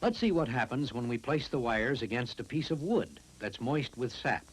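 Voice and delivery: normal talking voice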